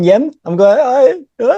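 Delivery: awkward Geordie accent attempt. Shown as a demonstration. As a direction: have a go at Geordie, half-embarrassed. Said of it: "Can you do a Geordie accent, [name]?".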